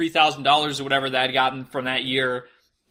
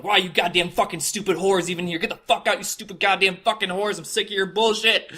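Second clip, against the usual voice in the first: mock angry rant; 4 semitones higher than usual. Reenacted hateful rant at women.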